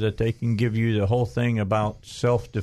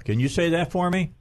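ordinary delivery

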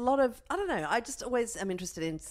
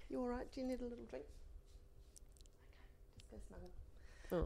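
soft, higher voice sinking into a whisper; hushed tender aside; 7 semitones higher, 15 dB quieter than usual. Real, not acted. Mother sending her sick son off to snuggle, hushed. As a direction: check gently, then whisper him away.

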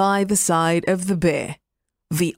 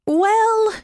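sly fox voice; 3 dB louder than usual. Acted. Fox begins his reply.

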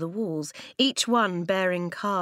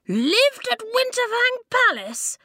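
shocked, much higher and louder, slow; spluttered; 6 dB louder than usual. A narrator's reading. Lofty hearing Eska lived at the palace, spluttering. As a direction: splutter in disbelief. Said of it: "Lofty spluttered".

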